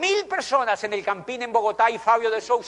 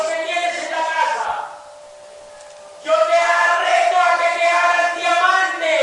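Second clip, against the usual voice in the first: loud, slow, stretched rallying call, higher; preaching challenge; 9 semitones higher, 9 dB louder, slower than usual. Real, not acted. Speaker daring the audience to become diamond, loud preaching. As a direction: challenge every listener at full force.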